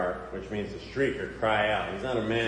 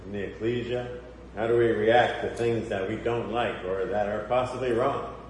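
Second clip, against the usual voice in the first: normal talking voice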